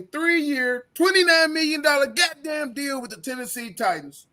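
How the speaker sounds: angry shout at the contract figure; outraged shout. Real, not acted. Ranting about Andre Dillard's deal, angry shout. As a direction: yell it like you can't believe the money.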